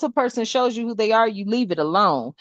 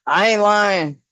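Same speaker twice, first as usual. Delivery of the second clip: normal talking voice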